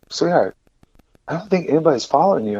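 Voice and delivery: calm a scared stranger gently; soft reassurance. Retold or imitated. Coffee shop girl soothing a panicked stranger, soft and kind.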